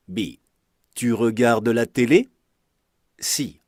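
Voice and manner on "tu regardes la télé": télé rises at the end; rising question tune. Shown as a demonstration. Teacher showing intonation questions, rising tune. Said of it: "by using a rising intonation".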